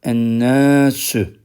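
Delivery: Ennâsü stretched out slowly; slow repeat. Shown as a demonstration. Teacher slowly repeating for students to follow, slow. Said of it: "lamlar okunmuyor".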